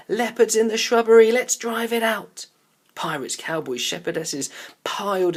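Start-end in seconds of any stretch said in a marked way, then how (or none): 0.1-2.5 loud, higher and fast; rally the others to the hunt; excited shout. A narrator's reading. Barney rallies the children to drive out the leopard, shouting.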